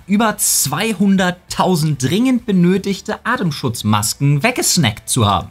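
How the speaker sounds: ordinary talk, nothing marked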